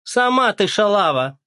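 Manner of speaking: put-on Оля yells back at the old woman; shouting back. A narrator's reading. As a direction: throw the insult right back. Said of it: "крикнула Оля".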